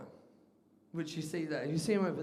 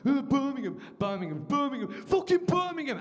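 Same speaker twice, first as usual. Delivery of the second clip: building to a loud, high Birmingham rant; furious Birmingham accent; angry Brummie outburst; 5 semitones higher, 4 dB louder than usual. Retold or imitated. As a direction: let the anger pull the Brummie accent out. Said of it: "you make someone angry, their accent actually comes out".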